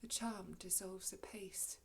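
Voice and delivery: very soft, low near-whisper; hushed observation. Acted. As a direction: murmur it as the spell lifts. Prospero watches the spell lift.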